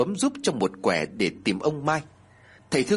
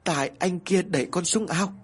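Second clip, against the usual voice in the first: put-on higher child's voice, out of breath; panting child; 6 semitones higher than usual. A narrator's reading. Boy pulled from the pond blaming someone, panting. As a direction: answer while catching your breath. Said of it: "thở hổn hển rồi đáp".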